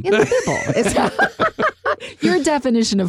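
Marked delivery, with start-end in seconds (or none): 0.0-2.2 goofy mispronounced Bible, laughing. Shown as a demonstration. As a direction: say bible as pible through laughter. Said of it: "That's what I call it with my kids".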